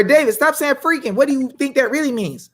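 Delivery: high-pitched imitation of a scolder; mock scolding. Acts out people scolding him.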